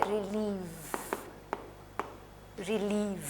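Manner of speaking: रिलीव with a long ee and a clear v at the end; verb relieve. Shown as a demonstration. Teacher demonstrating रिलीव with the ee held and a final v. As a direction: say रिलीव slowly, ending on a soft v. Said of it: "इसको verb में लिखूँगी तो ये ऐसे है".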